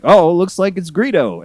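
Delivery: high, loud goofy announcer quote; chirpy mock explanation, up high; hammed-up cheerful aside; mock George Lucas. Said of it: "and he was like".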